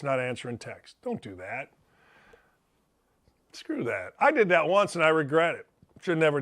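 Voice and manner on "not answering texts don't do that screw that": much lower, softer muttered disapproval; quiet aside. Real, not acted. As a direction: drop the voice for a private scolding. Scolding Phil for going dark.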